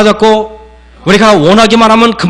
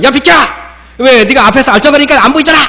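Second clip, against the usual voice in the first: higher, fast mock snapping at a kid; irritated yelling mom; 7 semitones higher, faster than usual. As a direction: imitate a mom shooing her child away. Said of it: "엄마가 보통 이다".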